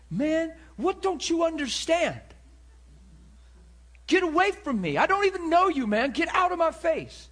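loud, high-pitched shouting at full volume; imitated angry yell. Preacher imitating the man who yelled at him, loud angry yell. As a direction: yell it like an enraged man driving someone off. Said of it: "That's how he talked to me".

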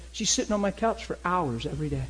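normal talking voice

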